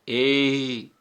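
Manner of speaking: ए said as a single steady vowel; vowel ए demo. Shown as a demonstration. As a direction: demonstrate ए slowly for students. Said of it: "अब बोल के देखो". (ए को एक ही ध्वनि में बोलना)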